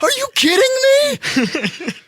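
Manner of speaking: very high, loud, incredulous squeal; starstruck disbelief. Retold or imitated. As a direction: shriek it like a fan overwhelmed. Retelling reaction to Mark Hamill.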